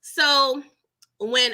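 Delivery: ordinary talk, nothing marked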